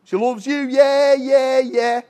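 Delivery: mock Scouse, dragged-out yeahs; lightly exaggerated Scouse. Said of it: "you remember the Beatles".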